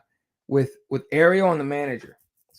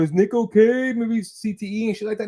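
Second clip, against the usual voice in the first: mock worried gossip, higher pitch; mock worried crowd; 7 semitones higher than usual. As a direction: imitate people fretting over Nick. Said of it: "everyone starts going".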